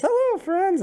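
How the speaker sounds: very high, loud mock yell; yelled greeting. Game master echoing the player's greeting to creatures, high mock yell. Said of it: "he yells".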